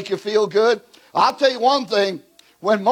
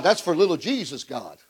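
usual speaking voice

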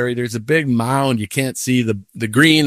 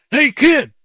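high, loud imitation of a gruff summons; retold yell; 12 semitones higher, 5 dB louder than usual. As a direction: call out as the man yelling after you. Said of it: "he yells at me".

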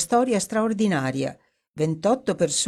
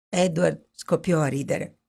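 plain reading voice

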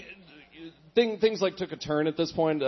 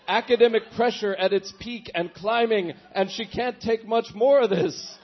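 put-on a higher, louder Star Trek crewman calling the Captain, barely in accent; attempted Scotty accent; 8 semitones higher, 4 dB louder than usual. Diary reader hamming a starship crisis report, failed Scotty voice. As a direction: ham it up like a panicked starship engineer. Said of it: "I can't do a Scotty accent".

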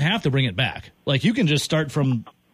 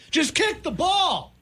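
a frustrated fan shouting the obvious at a player; exasperated shout; 12 semitones higher, slower than usual. Real, not acted. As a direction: kick punched in a high, slow yell.

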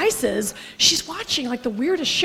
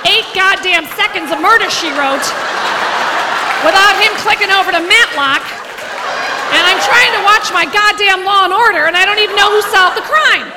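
imitating her mother's rant, louder and higher; angry mom rant; 4 semitones higher, 4 dB louder than usual. Mother complaining about dad and the remote, louder and higher. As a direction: rant like an exasperated old mother. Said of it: "he was constantly".

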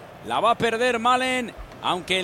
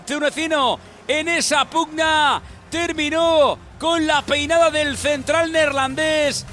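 slow drawn-out radio goal call; goal commentary; 5 semitones higher, 5 dB louder, slower than usual. Real, not acted. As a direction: call the goal live on air.

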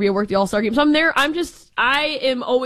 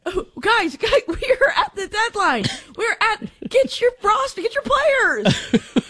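high, laughing pleading voice; hammed-up laughing plea; laughing mock plea; 9 semitones higher, slower than usual. Real, not acted. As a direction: beg the teams through giggles. Co-host mocking deadline, laughing.

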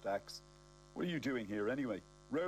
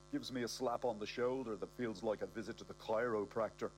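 ordinary delivery, nothing marked

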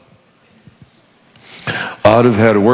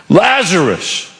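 higher, slow summoning name; slow, higher call to Lazarus; slow drawn-out call; 5 semitones higher, much slower than usual. Retold or imitated. Jesus calling the dead man.

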